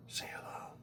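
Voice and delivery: hushed whisper to a cat; whispered coaxing. Real, not acted.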